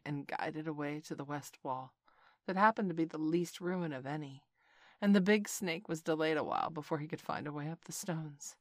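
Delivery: plain reading voice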